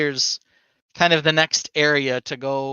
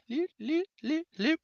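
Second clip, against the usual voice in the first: high sing-song; 14 semitones higher, 5 dB quieter than usual. Shown as a demonstration. Mimicking loopy vines.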